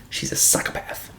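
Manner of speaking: a soft unvoiced whisper, as if sharing a secret; whispered aside. Real, not acted. Telling viewers about the villain, a hushed whisper. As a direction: drop to a whisper for the punchline.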